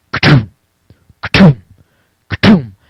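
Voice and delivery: short, punchy thud noise; imitated hatchet blows. Storyteller imitating someone hacking at the door, percussive thuds. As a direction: mimic heavy chops on wood. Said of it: "all of a sudden I hear".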